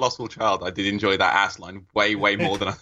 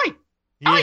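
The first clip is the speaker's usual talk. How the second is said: little dog's oi; 4 semitones higher, 5 dB louder than usual. Retold or imitated. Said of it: "it's more of a".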